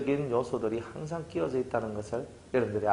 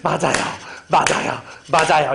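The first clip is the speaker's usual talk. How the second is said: louder each time, building emphatic yes; eager agreement; 6 dB louder than usual. Retold or imitated. Comforted listener shouting agreement with growing force. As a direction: pile it on, agreeing harder and harder. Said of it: "이래요".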